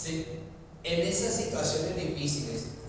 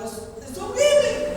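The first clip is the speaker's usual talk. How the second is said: anguished cry; 8 dB louder, slower than usual. Real, not acted. Preacher recalls God's silence.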